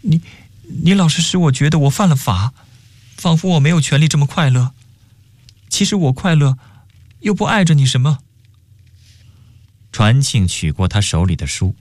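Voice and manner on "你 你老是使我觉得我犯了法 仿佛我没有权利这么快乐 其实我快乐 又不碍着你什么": high, plaintive reproach; choked-up questioning. A narrator's reading. Girl confronts the boy over her happiness, choked up. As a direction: protest in a raised, hurt voice. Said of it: "终于凄哽地质问道".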